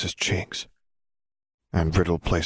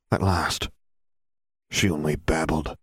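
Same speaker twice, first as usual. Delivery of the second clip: usual speaking voice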